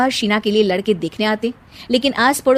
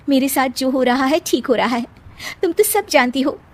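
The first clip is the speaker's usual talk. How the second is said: higher-pitched bride confiding in her doll; resigned young bride; 5 semitones higher than usual. Acted.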